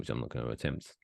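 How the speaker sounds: ordinary delivery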